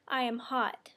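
ordinary talk, nothing marked

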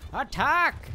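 loud, high rallying shout; battle cry. Pretend. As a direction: yell the charge to your ally.

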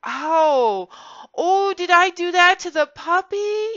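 put-on a child suddenly sorry for the puppy; dismayed child. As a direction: gasp it as a child realising the hurt. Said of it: "healthy child will go".

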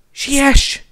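high, loud hurry-up cry; urgent call. A narrator's reading. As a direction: call out sharply to rush them. Urgent call during the escape. (sürgető, hangos kiáltás)

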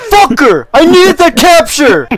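high, loud complaint; frustrated yell. Real, not acted. Gamer yelling at a teammate who stole his capture, angry and loud. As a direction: shout your annoyance.

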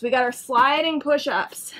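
ordinary delivery

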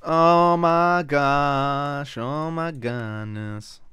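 very slow, stretched-out exclamation; exaggerated dismay. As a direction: drag every word out in mock dismay.

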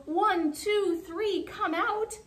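high, excited counting call; storybook crowd cheering someone out; crowd yell. A narrator's reading. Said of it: "The crowd yelled".

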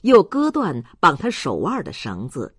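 ordinary delivery, nothing marked

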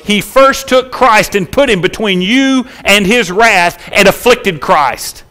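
high, forceful sermon with punched key words; impassioned preaching. Real, not acted. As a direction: build the weight of it for the congregation.

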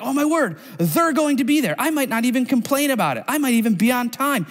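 high, gushing giddy voice; excited. Retold or imitated. Imagining family gathering with a favorite celebrity, high and giddy. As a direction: act thrilled the celebrity is coming. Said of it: "You'd be like".

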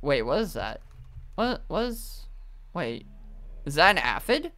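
usual speaking voice

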